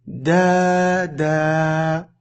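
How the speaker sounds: "Da, da" with both vowels held long; long vowels in rhythm. Shown as a demonstration. Teacher repeating the word in rhythm, "Da, da" slowly drawn out. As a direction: sustain each a in "Da, da", evenly timed. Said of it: "ce rythme-là".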